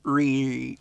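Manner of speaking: "Re" as a drawn-out "ree" between R and L; "Re" with the Japanese R-L mix. Shown as a demonstration. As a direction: blend R and L on "Re". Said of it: "an R and L mixing".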